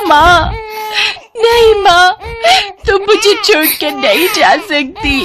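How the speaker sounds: sobbing high wail for a dead mother; crying. Acted. Girl crying over her dead mother, high sobbing wail. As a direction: beg through tears, voice high and breaking.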